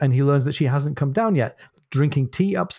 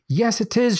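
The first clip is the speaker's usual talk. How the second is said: cried out; 9 semitones higher than usual. Acted. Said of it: "she cried".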